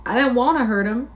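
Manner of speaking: high-pitched, upset wail; distressed cry. A narrator's reading. Lenny sorry he hurt Curly, crying out. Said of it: "cried Lenny".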